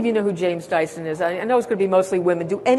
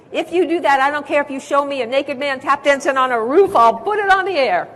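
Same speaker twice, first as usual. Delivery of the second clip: bold promise; 6 semitones higher, 3 dB louder than usual. Retold or imitated. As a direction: pitch raised higher and louder.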